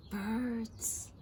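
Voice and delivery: soft, hushed "Birds."; mysterious. Acted. Frog teasing the curious fish: mysterious hush. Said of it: "said the frog mysteriously".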